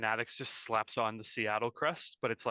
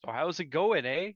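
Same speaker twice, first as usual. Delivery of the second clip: hammed-up Canadian, higher and louder; exaggerated Canadian accent; 5 semitones higher, 5 dB louder than usual. Teased to chat in Canadian, hammed-up Canadian accent. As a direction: lay the Canadian on thick. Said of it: "chat in Canadian".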